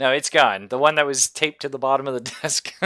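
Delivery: loud, high, fast half-laughing despair; mock dismay. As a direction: lament the lost stash, comically. GM voices dismayed searcher.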